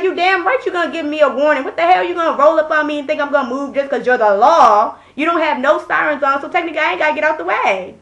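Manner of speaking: heated, defiant back-talk; indignant telling-off. Retold or imitated. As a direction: challenge the officer's authority. Telling off the officer, retold.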